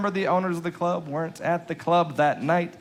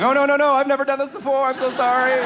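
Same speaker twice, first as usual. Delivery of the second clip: higher, louder panicked sorry; frantic apology; 10 semitones higher, 3 dB louder than usual. Retold or imitated. Comic retelling how he apologized to the falling heckler, in a high, panicky voice. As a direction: gush apologies, rattled. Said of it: "I was apologizing as he was falling".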